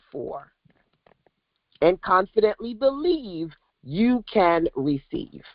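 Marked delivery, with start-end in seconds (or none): none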